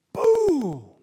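a high, loud breathy boom; sudden shouted sound-effect whisper; explosion. Acted. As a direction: burst out like fire striking. Fire falls from heaven.

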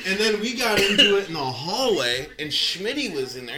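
ordinary talk

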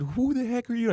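high, contained re-enacted shout; retold yelling. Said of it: "I started yelling at him".

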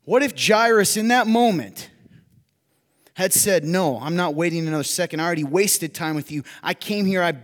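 loud, high, driving sermon voice; preaching at full force. Real, not acted. Pastor admitting his limits to the congregation, preaching loud. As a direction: preach it hard to the room.

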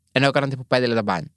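ordinary talk

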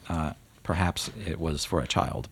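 ordinary talk, nothing marked

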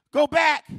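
high, loud preacher's command; shouted command. Acted. Preacher voicing the order given to Hagar, shouted command. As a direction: call it out forcefully.

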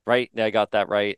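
ordinary talk, nothing marked

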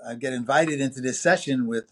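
ordinary delivery

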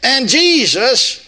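lofty scripture voice with a deliberate slip; mock misreading of scripture. Preacher jokingly misreads scripture to the congregation, lofty. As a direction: read it grandly and slip in a wrong name. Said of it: "Oh, no, I missed that".

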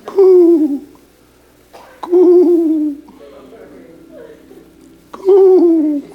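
high cooing like a dove. Retold or imitated. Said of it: "Jesus will go to cooing".

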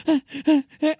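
high, loud alarmed gasps; panicked mum. Retold or imitated. Mum seeing the barb in the baby's lip, loud alarmed ahs. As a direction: mum realising the hook is stuck. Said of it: "she's there just going".

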